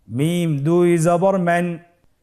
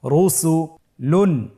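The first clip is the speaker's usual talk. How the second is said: drawn out, slow and deliberate; slow syllable reading. Shown as a demonstration. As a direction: stretch each syllable for learners. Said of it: "আপনারা আমার সাথে বলুন".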